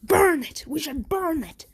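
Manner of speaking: mock frightened doctor's yell; panicked yelling doctor. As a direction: cry out to burn the creature. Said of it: "the doctor ... says".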